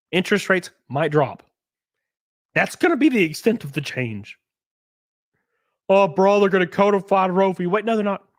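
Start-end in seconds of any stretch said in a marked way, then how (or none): 5.9-7.9 mocking quote. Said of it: "mock quote of opponents".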